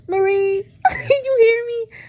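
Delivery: high, loud calling out; hammed-up tearful shout with a laugh; mock desperate call. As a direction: call across the garage, half crying. Retold calling out, crying.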